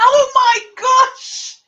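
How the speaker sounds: high, drawn-out laughing "Oh my gosh"; shocked laughing shriek. Real, not acted. Reacting to the news that the parrot turned evil, shrieking with laughter.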